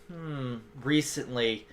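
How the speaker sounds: low, quiet, slow pondering; musing. Acted. Shopkeeper mulls a customer's question, musing quietly. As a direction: mull it over under your breath.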